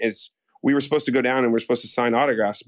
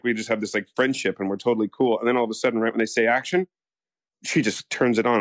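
usual speaking voice

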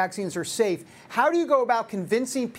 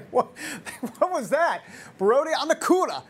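amused laugh; 5 semitones higher than usual. Real, not acted. Laughing at guest's joke.

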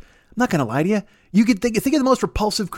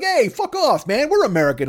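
mock America; 8 semitones higher than usual. America voiced arguing.

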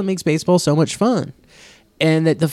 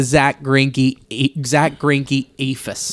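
very slow, stretched-out words; drawn out; 4 semitones lower, much slower than usual. Acted. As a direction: drag it out like a lazy lob. Mimicking a slow lob pitch.

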